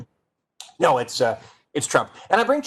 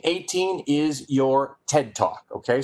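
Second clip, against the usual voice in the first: usual speaking voice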